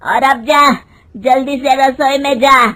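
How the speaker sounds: loud, scolding orders; angry mother-in-law. Acted. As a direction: order her off to the kitchen angrily. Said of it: "उसकी सास गुस्से में कहती है". (गुस्से में हुक्म देती सास)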